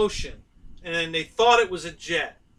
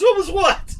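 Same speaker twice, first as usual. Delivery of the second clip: mock innocence; 13 semitones higher, 7 dB louder than usual. Dad plays innocent.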